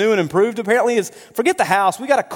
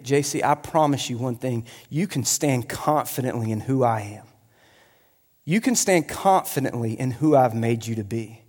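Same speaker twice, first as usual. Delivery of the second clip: lower, softer and measured; tender reassurance; 7 semitones lower, 5 dB quieter than usual. Acted. God promising the preacher at the beginning, tender reassurance. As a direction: promise it gently, with quiet sincerity.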